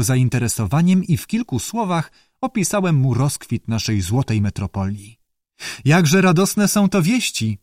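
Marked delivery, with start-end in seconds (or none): 5.8-7.6 mildly raised, pleased reading; openly pleased. Acted. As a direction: let a touch of open delight in. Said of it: "z nieskrywanym zadowoleniem".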